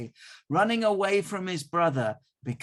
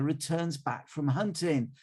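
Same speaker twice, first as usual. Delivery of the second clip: ordinary delivery, nothing marked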